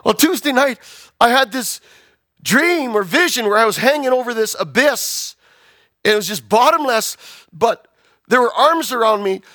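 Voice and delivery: high, intense account of a vision; acted fervent testimony. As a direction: build to an urgent demand. Daughter recounts her vision intensely.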